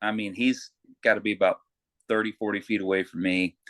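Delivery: ordinary talk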